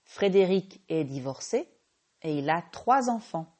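ordinary delivery

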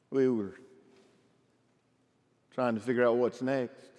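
normal talking voice

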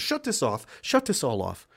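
a hint of a smug official closing things down; Walter Peck. Quoting the government officer who shuts it off, lightly mocking. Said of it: "Like Walter Peck from Ghostbusters".

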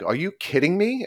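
high-pitched, incredulous retold outburst; mock-indignant question, pitched high without shouting; retold indignation. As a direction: replay your disbelief with pitch jumping up. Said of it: "I'm fuming to the point".

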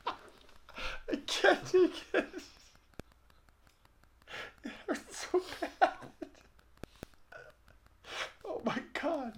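high, breathless laughter through words; can't stop laughing, it hurts; laughing hard. Real, not acted. Laughing uncontrollably.

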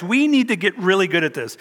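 usual speaking voice

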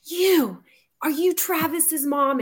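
hammed-up angry mom confronting a parent; imitating fuming woman. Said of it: "she's, like, fuming".

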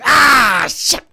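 high, loud mock yelp; mock pained cry. Imitating a wrestler cursing in pain, loud yelp. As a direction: yelp and curse at the punch. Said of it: "We hear Ric Flair".

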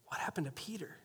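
soft, low murmur like gossip in a crowd; hushed whisper. Retold or imitated. Listeners murmuring about Peter, hushed and low. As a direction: whisper it to the person beside you.

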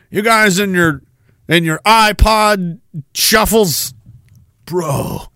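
ordinary delivery

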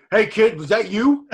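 a knowing, amused question; Steve Austin calling. Retold or imitated. Steve phoning AJ after spotting the car, slow and loud. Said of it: "He calls A J".

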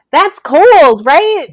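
high, loud outrage on that's cold; a burst of disbelief at the betrayal; 1 indignant emphasis. Real, not acted. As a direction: hit cold hard and high. Outraged at Duncan's murder.

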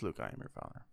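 breathy mocking whisper of the line; mock whisper, low and soft. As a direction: whisper it like a joke Vader.

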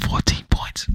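unvoiced hushed repeat; whisper. Real, not acted. Repeating Mahomes' score to viewers, whispered. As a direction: breathe it out like a secret. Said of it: "I gotta whisper that".

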